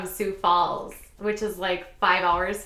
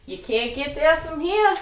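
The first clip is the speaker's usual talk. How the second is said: higher, hammed-up Down East Maine accent; 8 semitones higher, 3 dB louder than usual. Locals answering lost summer visitors, exaggerated Maine accent. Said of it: "I spent summers in Maine".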